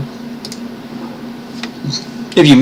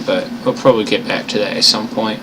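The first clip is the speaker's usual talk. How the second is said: ordinary delivery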